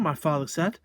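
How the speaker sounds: ordinary delivery, nothing marked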